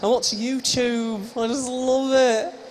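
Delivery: very slow, stretched-out mock speech; pretend signing voice. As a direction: draw each word out as if miming signs. Voices himself while pretend signing.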